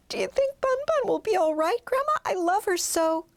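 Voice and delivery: put-on high child voice, anxious; little girl asking grandma about her lost bunny, high and worried. A narrator's reading. Sad child asks grandma.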